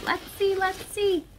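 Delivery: high sing-song searching. Real, not acted. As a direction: sing it up high while digging. Digging in box playfully.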